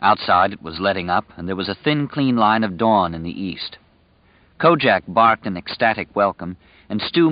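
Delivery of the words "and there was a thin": ordinary delivery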